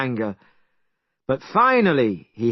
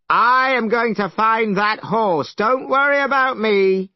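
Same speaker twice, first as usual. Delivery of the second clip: brash Monkey; 10 semitones higher, 4 dB louder than usual. Acted. Monkey boasts.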